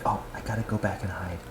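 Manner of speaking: low, fast whispered aside; voicing the dog's thought in a low, fast whisper. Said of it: "He's like".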